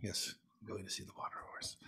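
soft whispered answer, barely voiced; whispered reply. His whispered reply to her.

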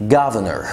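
governor said as guv-ner; governor, final R kept, middle R gone; governor without middle R. Shown as a demonstration. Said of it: "يبقى هنشيل ال R اللي في النص".

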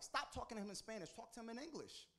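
high, insistent wife voice; wife's urgent advice. Retold or imitated. Wife tells him what to do.

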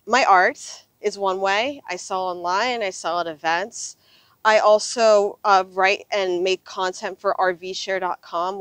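ordinary delivery

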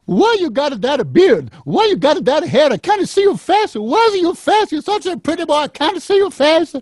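high scolding Italian granny; grandmother voice. Retold or imitated. Grandma scolding grandson about his beard, high fussy granny voice. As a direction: fuss over the beard in a high old-lady voice. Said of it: "And she goes".

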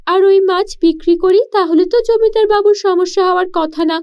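put-on a poor village girl puzzling aloud; young girl voice.

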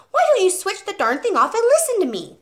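high, loud scolding demand; exasperated outburst. A narrator's reading. Teacher scolding the father over the TV, exasperated and loud. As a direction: finally snap and tell him off. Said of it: "Miss Honey began to lose patience".

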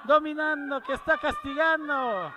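building shout. Real, not acted. Building call of the beating.

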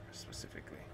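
soft unvoiced whisper. Real, not acted. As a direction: breathe the word out. Whispered aside.

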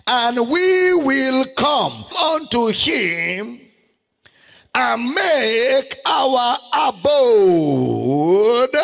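slow, loud, stretched preacher's cry; crying out at full force; preaching climax. Acted. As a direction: wail it to the congregation. Preaching climax over music.

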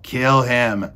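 shouted order. A narrator's reading. Cook orders Meg to kill the mouse, shouting. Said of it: "shouted Cook".

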